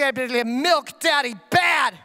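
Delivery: put-on slow, halting toddler babble; a two-year-old stringing words together, very slowly; a lightly voiced toddler scolding daddy; toddler gibberish. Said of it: "she said something like".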